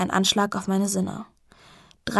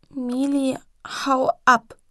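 put-on child echoing; 4 semitones higher, much slower than usual. Child echoes the shout.

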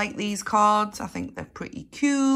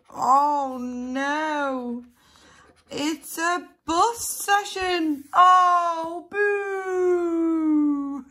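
mock disappointed boo; 7 semitones higher, much slower than usual. Losing card.

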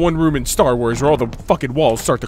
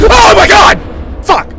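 very high, very loud shriek; startled scream; 11 dB louder than usual. Real, not acted. Player hit by a jump scare, startled scream. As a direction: scream it out at a sudden fright.

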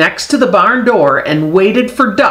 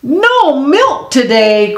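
farmer cries out; 7 semitones higher, slower than usual. Acted. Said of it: "cried the Farmer".

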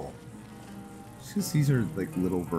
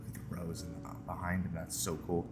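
very soft, almost whispered remark; hushed aside; 10 dB quieter than usual. Real, not acted. Player spots a rose while signing off, hushed aside. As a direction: drop the voice right down for a side comment.

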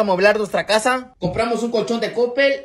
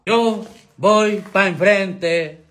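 slow, stretched-out alucín slang; alucín drawl; 2 semitones lower, much slower than usual. Acted. Tutorial host showing how alucines talk, drawn-out alucín voice. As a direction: drag every word out like an alucín. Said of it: "Necesitamos hablar como alucines".

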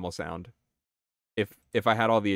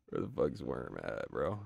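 much lower and softer aside; muttered; 10 semitones lower, 11 dB quieter than usual. Real, not acted. Streamer searching the menu, muttering to self. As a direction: mumble it under your breath.

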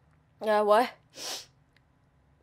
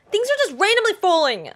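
loud, higher exasperated cry; exasperated outburst; 8 semitones higher, 5 dB louder than usual. Real, not acted. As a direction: throw up your hands at it. Things keep falling.

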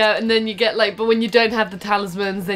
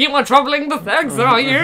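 voice of the sex; 8 semitones higher, 4 dB louder than usual. Pretend. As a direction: ham it up as a wailing villain. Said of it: "That's the voice of the sex".

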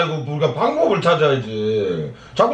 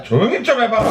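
raised voice; 7 semitones higher than usual. Real, not acted. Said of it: "또 소리 질러".

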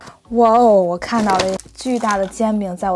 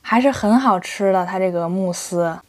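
chewing while talking; speaking after a bite; mouth full; 3 semitones lower than usual. Real, not acted. Seen 0: Chews cake with closed mouth, then speaks after swallowing.

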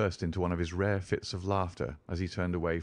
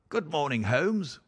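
high, hearty greeting in a baronet's voice; Sir Henry; 7 semitones higher than usual. Acted. Baronet greeting Holmes in the morning, animated. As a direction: hail a friend with lifted pitch.

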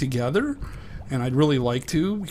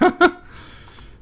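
high delighted laugh; 9 dB louder than usual. Real, not acted. Playing with dolphin.

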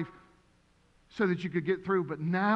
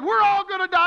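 higher, louder retold panic; panicked cry; 11 semitones higher, 8 dB louder than usual. Pastor retelling Mason panicking on the plane, high loud wail. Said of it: "He did".

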